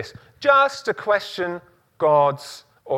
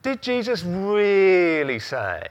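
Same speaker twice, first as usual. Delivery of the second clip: sly tempter voice; 4 semitones higher, slower than usual. Pretend. Voicing the devil's doubt.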